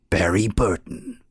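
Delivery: slow, put-on game intro announcer; intro man's voice. Podcast host cannot resist mimicking the game's intro, announcer voice. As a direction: draw the name out like an intro announcer. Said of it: "saying it in the intro man's voice".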